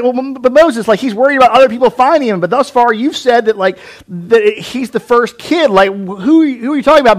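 high, loud, incredulous questioning; skeptic objecting. Retold or imitated. Skeptic challenging Moses, high and incredulous. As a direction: poke holes in the story. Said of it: "if you're like".

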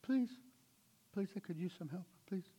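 soft, timid little plea; mild begging. Shown as a demonstration. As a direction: ask meekly, almost apologetic. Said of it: "You beg mildly".

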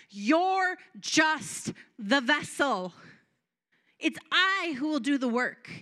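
loud, slow stern rebuke from God; angry Lord. Retold or imitated. The Holy Spirit scolding the preacher, loud slow rebuke. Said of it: "I heard the anger of the Lord".

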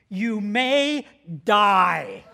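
slow, low, heavy delivery; ominous warning. Retold or imitated. Pastor reading the waiver sign on the wall, ominous and slow. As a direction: intone it slowly like a dire warning.

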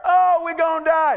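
high, loud wail of alarm; mock panic. Preacher voicing disciples who think Jesus is absent, mock panic. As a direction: cry out in hammed-up panic.

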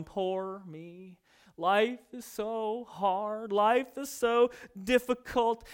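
slow, drawn-out self-pitying moan; milk every word of the pity; whiny complainer. Retold or imitated. Preacher imitates a gloomy complainer, slow and whiny.

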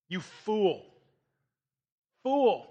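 a stern rebuke, building; God rebuking. Acted. God rebuking the rich man, stern. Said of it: "But God said to him".

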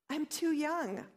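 a lightly voiced nervous youth protesting; timid young Jeremiah. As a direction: plead that you're too young. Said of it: "I said".

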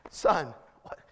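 short helpless chuckle into the words; laugh, then question in disbelief; retold disbelieving laugh. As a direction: re-enact a baffled parent's laugh. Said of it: "But I literally laughed".

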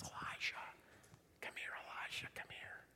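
very soft, intimate whisper; hushed, beckoning whisper; gentle whisper. Retold or imitated. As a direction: whisper as if only one person should hear.

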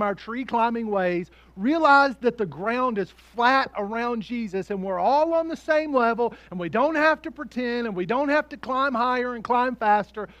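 rally the congregation, rising; preaching at full force. Acted. Preaching climax building.

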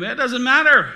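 loud, high mock dismissal; devil's dismissive lie. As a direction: toss it out loudly, like a lying tempter. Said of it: "The devil's lying to your kids".

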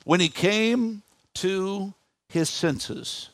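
very slow and drawn out; hushed-down, deliberate scripture phrase; slow, weighty repetition. Real, not acted. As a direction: let each word hang before the next. Repeats the underlined phrase once more.

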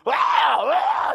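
a short hammed-up demon cry; demon shriek. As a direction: shriek it like the possessed man. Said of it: "he came out with shrieks".

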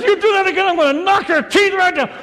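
high, loud furious warning; angry threat. Retold or imitated. Startled person yelling at prankster, high loud angry threat. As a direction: snap back at the prankster. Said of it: "No, they turn around".

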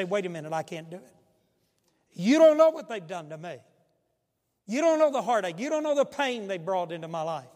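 voicing a hurt listener. Preacher voicing a hurting listener, loud protest. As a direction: cry out the pain of the wronged. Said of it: "You say".

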